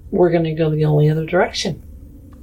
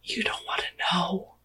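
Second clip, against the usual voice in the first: hushed, under the breath; whisper; 12 dB quieter than usual. Acted. Player whispers a warning to Sisa, then softly voiced. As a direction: whisper easing into a hushed murmur. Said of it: "I'm gonna whisper to him".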